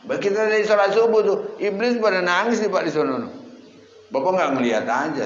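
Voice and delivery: high, loud sermon storytelling; animated preaching. Real, not acted. Preacher describing the devil weeping after dawn prayer, animated and loud.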